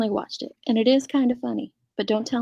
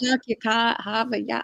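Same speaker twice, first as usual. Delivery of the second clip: bad Cockney attempt. Shown as a demonstration. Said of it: "No, it sounds ridiculous".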